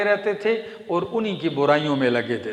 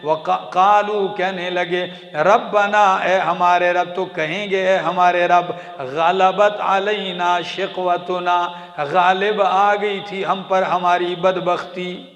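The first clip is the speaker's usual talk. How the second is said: chanted Quran verse, then ardent translation; preaching recitation; 4 dB louder than usual. Acted. Preacher reciting to congregation, fervent chant.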